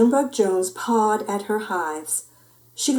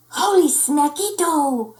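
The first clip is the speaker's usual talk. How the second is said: high, drawn-out exclamation; delighted kitten voice; excited kitty boy; 7 semitones higher, slower than usual. A narrator's reading. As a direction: exclaim it with mock-Spanish relish. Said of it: "exclaimed the kitty boy".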